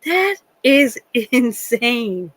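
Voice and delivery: slow, drawn-out disbelief; drawling astonishment, rising in pitch; incredulous. Retold or imitated. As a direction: stretch each word out, appalled and amused. Incredulous reaction to old stories.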